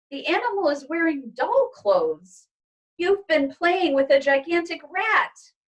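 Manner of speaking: a mother horrified by the pet; mother's voice. Acted.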